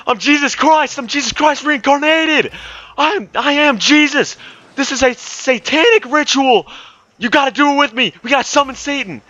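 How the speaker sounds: very high, loud raving proclamation; imitated screaming. Crazed bus rider proclaiming to passengers, imitated screaming. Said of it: "he just starts, like, screaming".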